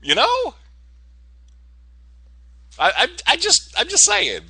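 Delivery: a sudden squeak of awkward defensiveness; flustered squeak. Real, not acted.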